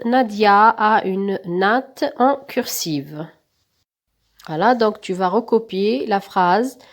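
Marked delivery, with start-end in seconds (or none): 0.1-2.1 slow dictation. Shown as a demonstration. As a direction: read the sentence slowly for copying. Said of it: "vous allez recopier la phrase".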